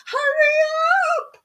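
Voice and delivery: hammed-up impatient yell; impatient mock shout. Mimics impatient viewers calling out.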